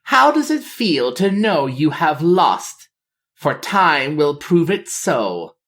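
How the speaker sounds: sing-song mockery. A narrator's reading. Sira taunting the captive king, mocking sing-song. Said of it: "she mocked in a sing-song voice".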